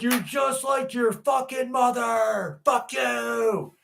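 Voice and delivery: put-on bratty child snapping; mock kid voice. Dad playing the son insulting him, high shout. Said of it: "I'd be like".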